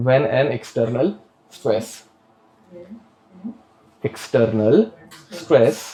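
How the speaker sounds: drawn-out dictation, repeating each phrase; slow dictation. Acted. Teacher dictating the definition to the class, slow and repeated. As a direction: dictate slowly for students to write.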